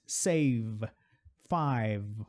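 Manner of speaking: ordinary talk, nothing marked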